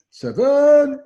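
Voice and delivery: loud, high, drawn-out number call; bingo caller. Retold or imitated. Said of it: "it was like a bingo caller".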